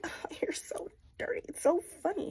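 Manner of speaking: high, breathy sneer of a snob; mocking. Snobby Kathy teasing Heathcliff, high mock sneer. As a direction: look down your nose at him.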